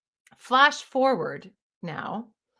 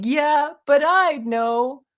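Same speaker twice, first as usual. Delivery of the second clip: higher, loud, slow whine; whiny ego; 6 semitones higher, 5 dB louder, slower than usual. Retold or imitated. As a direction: stretch it out stubbornly. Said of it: "my ego".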